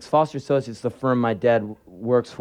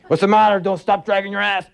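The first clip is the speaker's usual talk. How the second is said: gruff dad scolding; 6 semitones higher than usual. Retold or imitated.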